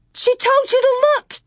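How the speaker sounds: very high, loud screech; little sister screaming in panic. A narrator's reading. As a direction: scream it at her. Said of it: "Carrie screamed".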